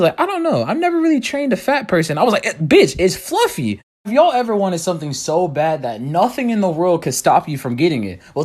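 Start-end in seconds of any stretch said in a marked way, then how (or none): 0.2-2.2 put-on high, prissy girl's voice. Girl voiced in story. 2.5-3.8 slow, sassy drawn-out comeback. Retold or imitated.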